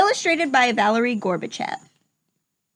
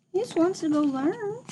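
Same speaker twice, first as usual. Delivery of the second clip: sympathetic; 6 semitones higher, 6 dB quieter than usual. Real, not acted. Teacher sympathetic aside.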